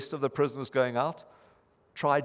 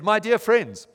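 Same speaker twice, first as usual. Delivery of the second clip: preacher's opening; 8 semitones higher, 7 dB louder than usual. Retold or imitated. Lay preacher starts his sermon.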